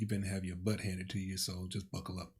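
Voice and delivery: dry, joking sarcasm; deadpan teasing aside, trailing off softly; sarcastic. Real, not acted. Husband joking about what his wife's phrase means, sarcastic. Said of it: "I'm being sarcastic".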